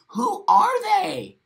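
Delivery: high, slow outraged cry; venting at whoever makes the rules, high and outraged; indignant cry. Real, not acted. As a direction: demand it of the sky, high and slow. Ranting at unseen authorities.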